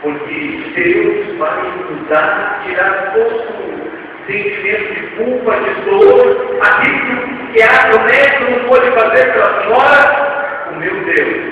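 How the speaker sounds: high, loud, building proclamation; full-force preaching. Acted. Preacher proclaims to the congregation, full-force preaching. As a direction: preach it with rising fire.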